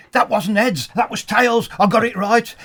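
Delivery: hammed-up angry footballer arguing; indignant protest. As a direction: do the manager insisting he called it right. Retold manager chasing, arguing coin toss.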